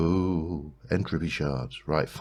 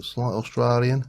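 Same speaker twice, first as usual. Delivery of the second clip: higher-pitched mock Australian; slight, jokey Australian twang; terrible Australian accent; 7 semitones higher than usual. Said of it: "my Australian accent impersonation is terrible".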